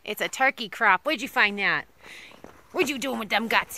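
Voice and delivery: normal talking voice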